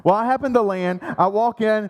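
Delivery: normal talking voice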